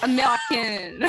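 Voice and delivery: put-on slow French-accented American, like a slur; French disdain. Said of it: "making it sound like a slur".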